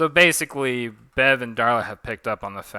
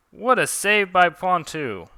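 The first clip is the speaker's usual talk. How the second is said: high, loud play-by-play call; excited sportscaster voice; match announcer; 9 semitones higher, 8 dB louder, slower than usual. Acted. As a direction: call the action like a ringside commentator. Commentator cheers a save.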